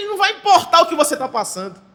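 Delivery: usual speaking voice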